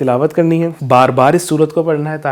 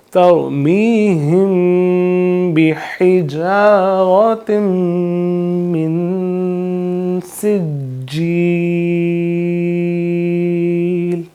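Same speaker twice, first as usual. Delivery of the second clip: Quranic recitation; 3 semitones higher, much slower than usual. Shown as a demonstration. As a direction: recite it slowly with nasal ghunna. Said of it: "اس طریقے سے ہم اس کی تلاوت کریں گے".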